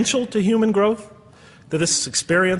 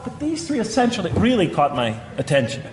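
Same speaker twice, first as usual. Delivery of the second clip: ordinary delivery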